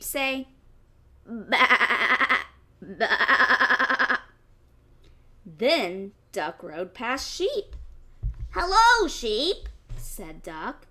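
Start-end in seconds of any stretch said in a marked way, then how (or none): 1.3-4.2 louder bleating sheep. Acted. Sheep voiced in story. 8.5-9.7 louder, higher friendly duck greeting; cheery duck. Acted.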